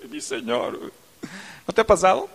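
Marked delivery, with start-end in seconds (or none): 0.0-0.9 teary laugh. Retold or imitated. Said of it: "empiezas a llorar".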